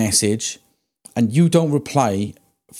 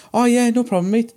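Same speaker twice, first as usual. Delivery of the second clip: higher, overly friendly; mock cheerful obliging; 10 semitones higher than usual. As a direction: play the eager-to-please tattooist. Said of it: "I would be like".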